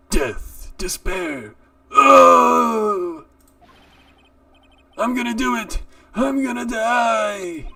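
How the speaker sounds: high, slow dramatic wail; hammed-up wailing. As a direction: wail like a doomed man. Voicing wailing client.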